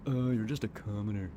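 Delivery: lightly voiced rich snob; cocky rich boy. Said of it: "I've always done cocky rich boy".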